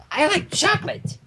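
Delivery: high, gushing and giddy; excited Pinkie Pie gushing; bubbly excited pony. Acted. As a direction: squeal it like a kid on sugar. Pinkie voiced.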